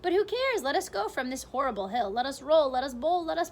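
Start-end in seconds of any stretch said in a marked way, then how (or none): 0.0-0.6 excited. Acted. Centipede's rousing poem climax.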